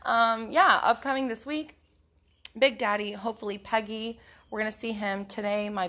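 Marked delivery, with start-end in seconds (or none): none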